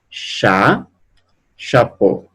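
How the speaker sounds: chapeau said the French way, as sha-po; French word chapeau; French pronunciation. Shown as a demonstration. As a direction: say chapeau in French, stress on the final syllable.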